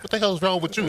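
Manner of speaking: playfully baffled, ribbing a crying friend; incredulous Mario. Retold or imitated. Mario ribbing his teary teammate on the field, high mock disbelief. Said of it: "hugs me like".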